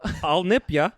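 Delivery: mock-Australian vampire teasing. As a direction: growl a mock Aussie nip threat. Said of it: "I'm an Australian vampire".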